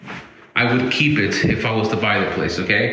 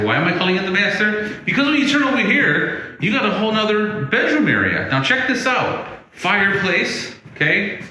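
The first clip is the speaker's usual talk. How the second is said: excited reveal; 9 semitones higher than usual. Real, not acted. Realtor touring a house, thrilled to show the extra bedroom, excited high voice. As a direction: pitch jumps way up, eager and bright-eyed.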